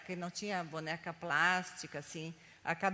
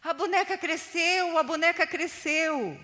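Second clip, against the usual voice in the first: loud, high-pitched cry of discovery; excited shout; 9 semitones higher, 10 dB louder than usual. Acted. Boy at the dug-up spot, shouting out. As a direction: shout it out like a startled child. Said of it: "ele gritou assim".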